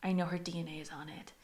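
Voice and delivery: low, hushed retold quote; hushed request. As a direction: say it under your breath. Acting out a secretive request.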